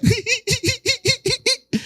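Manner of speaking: high, far-off wail between laughing and crying; high wailing sob-laugh. Shown as a demonstration. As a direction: demo a cry that sounds like laughter. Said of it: "Porque lloran así".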